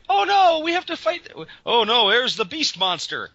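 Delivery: high, hammed-up cartoon panic; mock alarm. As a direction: imitate a panicked cartoon hero. Mocking cartoon heroes' alarm.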